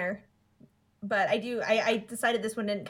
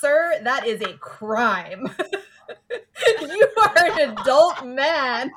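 laughing scold; 5 semitones higher, 8 dB louder, much slower than usual. Acted. Mock scolding a creepy adult.

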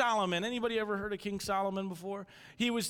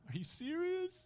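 high, incredulous question; exasperated disbelief; 13 semitones higher, 4 dB quieter than usual. Retold or imitated. Dad after the rabbit runs off, incredulous. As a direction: let the pitch climb in disbelief.

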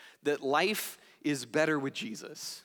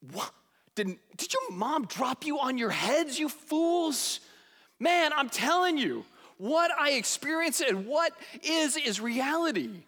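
higher, mock disbelief at the fools; exasperated John; 7 semitones higher than usual. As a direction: scoff then insist. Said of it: "And he's like".

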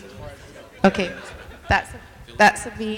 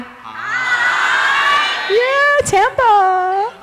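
high excited greeting; 14 semitones higher, much slower than usual. Real, not acted. Greeting crowd on livestream.

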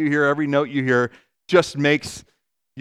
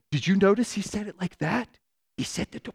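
softer, secretive, like sharing a suspicion; hushed suspicious aside; 3 semitones higher, 7 dB quieter than usual. Retold or imitated. An anxious listener hushedly reliving a coworker's remark, suspicious. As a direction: lean in and half-whisper the suspicion.

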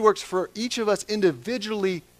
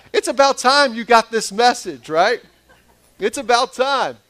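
mock pointed jab; 7 semitones higher, 8 dB louder than usual. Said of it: "and you're like".

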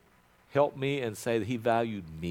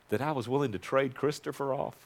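ordinary talk

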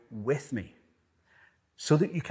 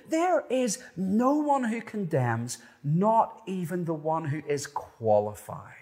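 loud, high impassioned preaching; preaching at full force; 8 semitones higher, 4 dB louder than usual. Acted. Preacher rousing the congregation, impassioned and loud. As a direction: build it like a preacher at full cry.

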